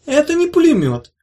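high, loud know-it-all retort; mocking correction. A narrator's reading. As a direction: correct him with a smirk. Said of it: "сказала Улита".

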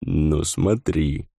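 slow, knowing warning; meaningful. A narrator's reading. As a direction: let it hang with meaning. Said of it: "многозначительно произнёс дух".

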